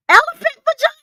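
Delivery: high, baffled mock question; incredulous. Commentator ridiculing a rival's insult, incredulous high squeak.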